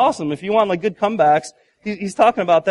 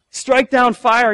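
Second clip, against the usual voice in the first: pleading cry; 4 semitones higher, 7 dB louder, much slower than usual. Retold or imitated. Prophets begging for fire.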